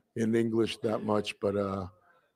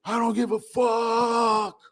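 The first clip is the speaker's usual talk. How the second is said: defiant shout; 10 semitones higher, slower than usual. Retold or imitated. Boy standing over the bully daring the rest. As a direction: dare the crowd to come at him. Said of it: "I go".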